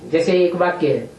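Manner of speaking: usual speaking voice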